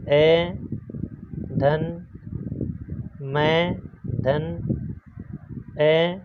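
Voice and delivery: slow teacher breakdown into sound parts; sound-by-sound split. Shown as a demonstration. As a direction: pause between each separate sound. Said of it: "इस प्रकार से इसके टुकड़े कर सकते हैं".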